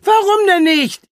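high, loud protesting question; impatient outcry. Acted. Franka challenges the captain's delay, crying out impatiently. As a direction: demand it, pitch shooting up.

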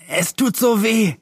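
put-on a hurt boy whining about the pain; whimpering. A narrator's reading. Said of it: "jammerte Dick".

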